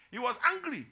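loud, high, indignant preaching; acted anger. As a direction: thunder out the older son's anger. Dramatizes the older son's anger.

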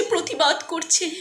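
ordinary delivery

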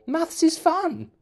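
very high, slow, cheesy teacher; sing-song fake cheer. Mimicking teacher sayings.

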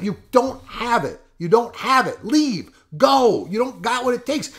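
high-pitched, relentless volley of orders; re-enacted berating. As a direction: rip into him, pitched high throughout. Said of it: "So I'm rifling on him".

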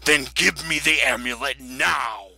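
loud, drawn-out villainous order; commanding demand. Acted. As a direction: demand it forcefully, stretching the words.

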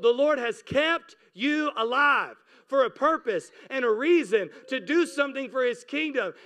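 ordinary talk, nothing marked

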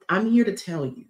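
ordinary talk, nothing marked

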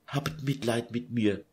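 breaking down into a soft whisper; sobbing whisper. Acted. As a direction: plead through tears, barely audible. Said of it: "Schluchzend warf sie sich".

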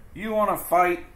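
high, loud, picking a fight; grouchy ladybug. Acted. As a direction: taunt them to fight. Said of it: "said the grouchy ladybug".

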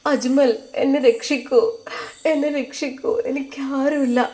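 put-on high, soft imagined plea of a helpless girl; pleading girl. Hamda begging Ajmal to save her, high and soft. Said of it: "കേണപേക്ഷിക്കുന്ന പോലെയാണ്".

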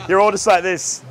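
ordinary delivery

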